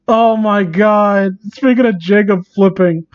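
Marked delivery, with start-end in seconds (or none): none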